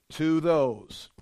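"to those" stressed, falling at the end; emphatic. Shown as a demonstration. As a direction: land firmly on "to those". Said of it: "Those are in the emphatic position".

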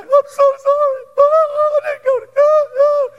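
high, loud wailing apology; sobbing apology. Retold or imitated. Wife wailing sorry to husband over his hair, high sobbing. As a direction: imitate the wife's hysterical crying. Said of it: "then she's like".

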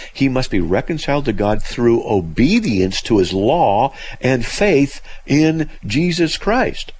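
slow, deliberate reading with rising pitch; emphatic quote reading. Acted. Radio host reading a quote he will call heresy, slow and emphatic. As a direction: read it out weightily, building toward the end. Reads out a quotation on air.

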